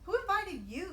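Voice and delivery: high, loud, rude challenge; snappish. A narrator's reading. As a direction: snap at the uninvited guest. Grumpy cactus snapping at lizard.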